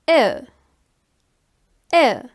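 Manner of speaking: "E" said as the German ö, a rounded eh; German umlaut sound. Shown as a demonstration. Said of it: "is pronounced like".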